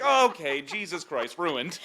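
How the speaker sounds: high, laughing mock despair; mock exasperation. Son reacting to mom's clichés, laughing exasperation. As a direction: laugh it out as if it's all ruined. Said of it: "now I'm like".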